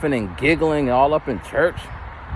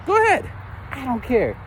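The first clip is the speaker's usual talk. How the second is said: high, sing-out dismissive dare; mock-breezy indifference; 9 semitones higher than usual. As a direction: brush off the kids' threat, pitched up. Teacher dismissing complaining kids.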